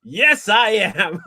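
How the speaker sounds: put-on very slow, loud American accent. Covering up after being rumbled, drawn-out fake American accent. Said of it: "I'll do an American accent".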